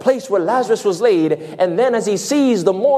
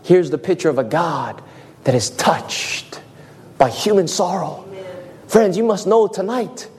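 ordinary talk, nothing marked

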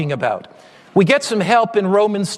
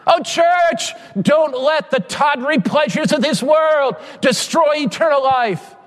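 high pleading preacher, full force; impassioned preaching; 6 semitones higher than usual. Acted. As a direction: thunder the plea to the church.

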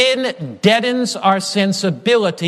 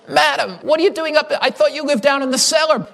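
put-on higher, fast surprised young man's voice; young elder, startled; 7 semitones higher, faster than usual. Young church elder surprised to find the old woman upstairs, higher voice. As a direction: act out a puzzled young elder calling up to her. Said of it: "he said".

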